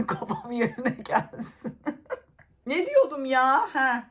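slow words through helpless giggles; laughing too hard to get the words out; real giggling. Real, not acted. Vlogger to viewers, losing it, slow and giggly. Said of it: "ya konuşamıyorum".

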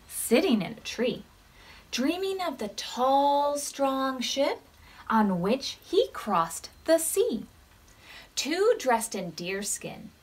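gentle storybook read-aloud; reading to children. Acted. Teacher reading a counting story to the class, gently. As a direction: read it like story time for little ones.

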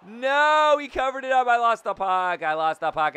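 high, loud cry of dismay; panicked yell. Real, not acted. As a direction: yell it as the puck slips away.

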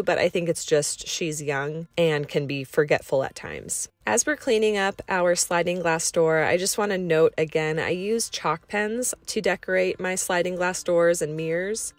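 ordinary talk, nothing marked

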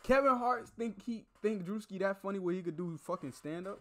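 hyped reaction. Real, not acted. Cohost loudly hyping the guest's story. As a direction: shout your approval.

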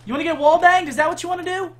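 high, loud furious shouts; angry yelling. Real, not acted. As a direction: bark taunts at the enemies. Yelling at enemy players.

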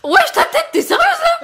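high, loud, laughing disbelief; laughing shout. Real, not acted. Reacting to friend's face.

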